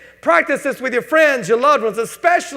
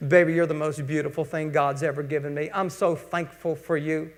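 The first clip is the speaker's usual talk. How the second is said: soft, hushed sweet talk to a spouse; tender love words; 6 semitones lower, 8 dB quieter than usual. Retold or imitated. Husband softly telling his wife how thankful he is. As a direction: murmur it tenderly like a wedding night. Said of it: "Words of love and tenderness".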